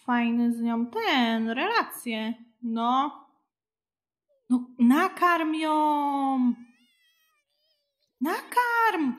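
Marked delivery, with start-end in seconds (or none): 4.5-9.1 slow, loud exasperated order, rising high on the last word; frustrated shout. Real, not acted. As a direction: yell the command at the stubborn sim. Frustrated at sim not feeding. (sfrustrowany krzyk)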